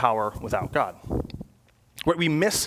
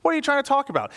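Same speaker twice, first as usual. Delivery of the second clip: mock indignant question; 10 semitones higher than usual.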